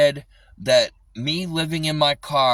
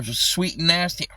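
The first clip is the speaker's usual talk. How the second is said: usual speaking voice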